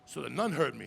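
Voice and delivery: ordinary delivery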